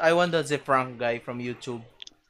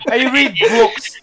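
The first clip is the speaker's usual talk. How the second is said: slow, loud, higher goofy voice; silly voice; 9 semitones higher, 10 dB louder, much slower than usual. Pretend. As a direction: ham it up in a silly voice. Mock voice, joking bit.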